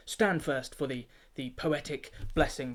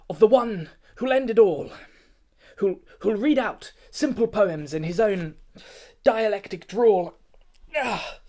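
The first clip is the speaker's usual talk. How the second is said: higher, louder, oracle-like prophecy ending in a whisper; prophetic trance; 6 semitones higher, 6 dB louder than usual. Acted. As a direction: rise into a seer's frenzy as the vision comes. Keeper seized by a prophetic vision.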